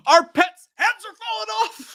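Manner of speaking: high, loud and slow quoted line; movie quote impression. Streamer quoting a chat message from the movie, hammed up high. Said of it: "Somebody in the chat already said".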